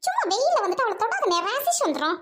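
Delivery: haughty sister scolding at her gate; snobbish rich sister. Acted. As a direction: talk down to the noisy crowd at the gate. Sister stops brother touching baby.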